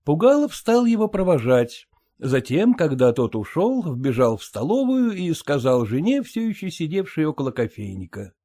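plain reading voice

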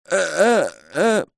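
high-pitched stuck syllables, repeated; stuck stammer. A narrator's reading. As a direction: stammer helplessly on one high sound. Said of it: "заело его". (заикается, заело, высоким голосом)

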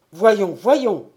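loud, coaxing call; urgent call. Acted. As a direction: shake him out of it with volume. Sacristan urging frozen man down.

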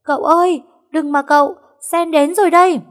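very high, loud scream across the bridge; shrieking at full pitch from a distance; desperate shout. Acted. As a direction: yell to stop him in panic. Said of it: "Tiếng hét của nó như muốn rung cả trời đất".

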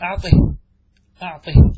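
أعطه called out sharply, ending on the ha of silence; loud command. Shown as a demonstration. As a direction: bark the command أعطه loudly. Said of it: "فتقول في أعطي". (أمر بصوت عال: أعطه)

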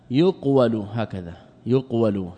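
"يقول" as yuq-walu, u on the y, a on the w; vowelling demonstration. Shown as a demonstration. Teacher telling students how to vowel it, "يقول" as yuq-walu. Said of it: "اضبطها بضم الياء وفتح".

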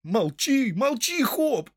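high, urgent hushing cry; alarmed shout. A narrator's reading. Villagers silence Hob mid-sentence, crying out in alarm. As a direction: cut him off with a high-pitched cry. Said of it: "закричало сразу несколько голосов".

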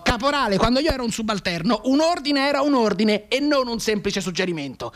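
officer yelling at subordinate; colonel's angry shout. Acted. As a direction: scold the corporal furiously. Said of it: "chiamò il caporale e urlò".

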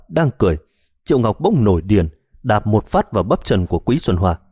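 ordinary delivery

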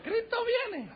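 very high squeaky comic falsetto; tiny worm voice. Pretend. Tequila worm begging the drinker not to swallow it, tiny worm voice. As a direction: play a little worm pleading in a tiny high voice. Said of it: "Hasta el gusanito te dice".

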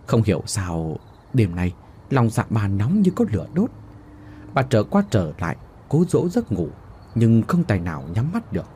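ordinary delivery

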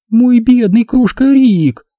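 high, slow cooing woman; mock pity. A narrator's reading. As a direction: coo with false sympathy. Mock pity before an angry accusation. (с притворной жалостью, женским голосом)

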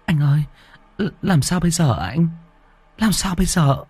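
frightened plea. Acted. Dung turns to Hùng, panicked and pleading. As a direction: beg for an answer, shaken.

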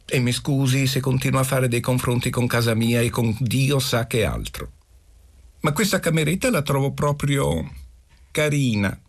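put-on high-pitched boy, complaining then placating; pleading schoolboy, boldly frank. A narrator's reading. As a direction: voice an apologetic pupil, pitched high. Boy answers his mistress.

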